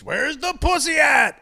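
high, drawn-out mock holler; mock scream. Mocking the guy who screams in bars, mock scream. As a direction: imitate a desperate guy calling out, not full volume. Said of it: "Anybody who screams".

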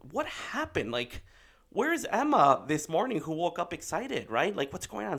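high-pitched, incredulous questioning; baffled parent pressing for an answer; retold bewilderment. Dad baffled by daughter's sudden refusal, incredulous.

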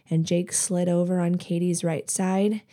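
ordinary delivery, nothing marked

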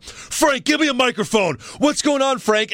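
high-pitched, exasperated call for help; Triple H. Retold or imitated. Host acting out a wrestler whose mic died, high exasperated call. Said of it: "like, Triple H goes, he's like".

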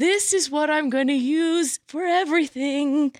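high, airy voice of revelation; mock awe. Player smitten with a new weapon, mock awe. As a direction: float it up high as if enchanted. Acts out finding the perfect weapon.

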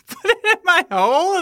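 amused disbelief. Real, not acted. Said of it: "Is that what you said?".

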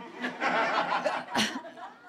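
unvoiced laugh; breathy laugh. Real, not acted. Reader snickering.

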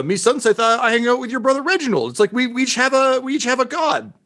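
high, fast, eager rush; thrilled to spell out the symmetry, pitch climbing; excited. Acted. Character tells her brother they each have a god, excited and high.

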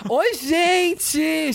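high campy queen greeting; campy drag queen. Retold or imitated. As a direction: sing it out like a flamboyant queen. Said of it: "Fábio Porchat fazendo uma bichona maravilhosa".

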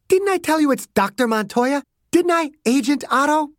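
high, cutting voice; a hint of anger. A narrator's reading. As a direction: taunt him sharply. Margaret rebukes Clarence.

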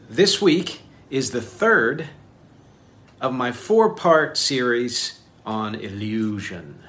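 slow, punched announcement with big pauses; showy announcement. Pretend. Showy announcement of series theme.

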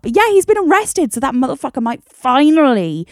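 gleeful, high and loud; cheering the arrest; excited. Real, not acted.

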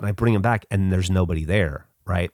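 ordinary delivery

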